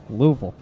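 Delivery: "Louisville" again as LOO-vul, firm fall; Louisville repeat. Shown as a demonstration. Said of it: "how do you pronounce that again".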